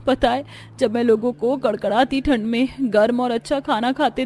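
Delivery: long wistfully for something out of reach; wistful child. Acted. Child longs for warm food. (हसरत भरी बच्चे की आवाज़)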